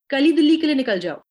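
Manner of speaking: loud, fast, urgent order; urgent doctor. Retold or imitated. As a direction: urge them to leave now. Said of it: "एक doctor ने कहा".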